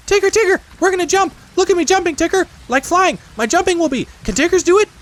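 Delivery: very high, loud squealing cry; wildly excited Roo. Acted. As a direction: shriek it all out in wild glee. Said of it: "he was wildly excited, and cried out".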